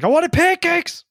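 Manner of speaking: high, slow whine of a spoiled tourist; whiny mock demand. Joking about being crazy on reality TV, a high slow whiny demand. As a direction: whimper it like a brat wanting breakfast. Imitates a whiny lunatic contestant.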